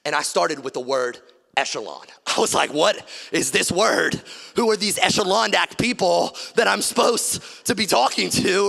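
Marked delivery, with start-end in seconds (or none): 2.7-8.7 loud, mock-bewildered questioning; baffled outburst. Preacher recalls meeting the word echelon, loud and baffled. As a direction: push it out loudly, comically baffled.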